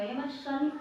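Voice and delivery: ordinary delivery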